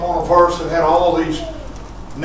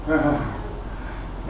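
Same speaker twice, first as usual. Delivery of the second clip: shouted, high, rhythmic preaching with "uh" breaths; full-force preaching; 8 semitones higher, 9 dB louder than usual. Acted. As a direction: preach it out at the top of the voice.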